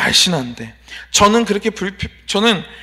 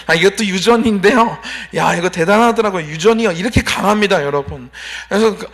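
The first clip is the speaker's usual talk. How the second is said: usual speaking voice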